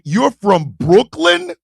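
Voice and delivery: slow, rising incredulous question; retold disbelief. He repeats it in disbelief.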